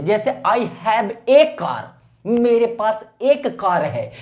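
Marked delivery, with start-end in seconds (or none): 0.5-1.8 "a" said as a full "ay" in I have a car, slowly; emphatic article. Shown as a demonstration. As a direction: stress "a" as "ay" before car. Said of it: "आपको ए बोला जाता है". ("a" पर दबाव देकर "ए" उच्चारण)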